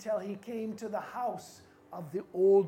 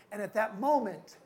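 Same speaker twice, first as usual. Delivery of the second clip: usual speaking voice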